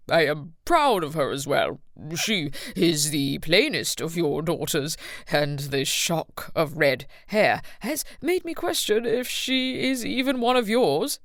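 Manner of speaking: sly, slow tipsy uncle voice; mocking uncle, leaning in; conspiratorial uncle. A narrator's reading. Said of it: "with a conspiratorial laugh".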